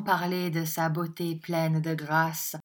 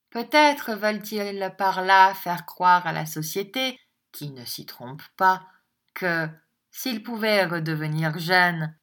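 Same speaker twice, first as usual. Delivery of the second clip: ordinary delivery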